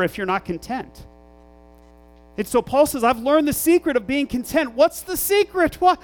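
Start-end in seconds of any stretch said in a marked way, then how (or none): 2.4-6.0 higher, louder fired-up preaching; preaching at full force. Acted. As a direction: build the fire of the sermon. Preacher builds to climax.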